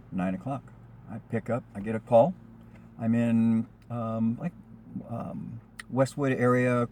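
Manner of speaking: usual speaking voice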